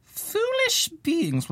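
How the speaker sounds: high, slow pompous reading; mock god voice. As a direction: read it like a grand decree. Reading the god's letter aloud.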